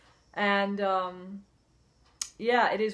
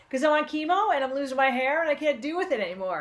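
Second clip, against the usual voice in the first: higher, louder retold outburst, words tumbling out; distraught outburst; 6 semitones higher, 6 dB louder than usual. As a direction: relive the moment of snapping at the stylist. Retelling breakdown at salon.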